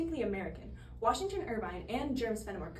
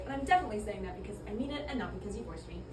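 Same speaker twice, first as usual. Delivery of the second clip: rushed aside; faster than usual. Acted. Forced compliment, rushed aside.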